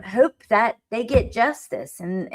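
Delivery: ordinary talk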